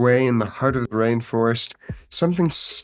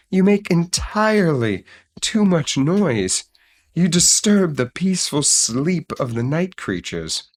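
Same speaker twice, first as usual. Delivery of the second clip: higher, complaining sloth voice with swooping tune; sleepy-eyed sloth; 6 semitones higher than usual. A narrator's reading. Sloth having a talk with the noisy bird, complaining. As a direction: scold as a storybook sloth. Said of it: "The sleepy-eyed sloth had a talk".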